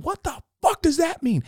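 high-pitched frantic demand; acted shrieking disbelief; screamed. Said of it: "And I screamed".

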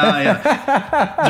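drawn-out laughing yeah; laughing at the memory of the scruffy visitor; slow laughing. Real, not acted. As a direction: stretch it slowly through a laugh. Laughing at old man's look.

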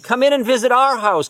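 put-on little girl yells to the guru from the window, excited; child yelling. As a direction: call out to him like a thrilled little girl. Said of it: "She yells at Maharaji".

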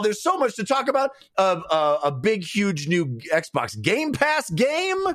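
big announcer energy with high-pitched peaks; excited podcast opener; hyped show intro. Acted. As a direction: sell it like a ringside host, stretching key words.

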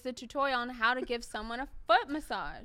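loud, high, exasperated denial; loud protest. Real, not acted. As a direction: shout it down, indignant. Accused, insists on his words.

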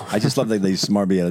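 ordinary talk, nothing marked

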